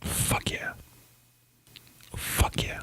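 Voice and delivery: hushed, whispered cheer; playful whisper. Pretend. Co-host answering an offer of a tripod, whispered. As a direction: whisper your approval right into the mic. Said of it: "We're gonna whisper".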